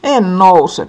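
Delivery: loud, high defiant no; shrieking refusal. A narrator's reading. Little sister screaming at mother in a tantrum. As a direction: scream that you won't get up. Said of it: "pikkusisku kiljuu".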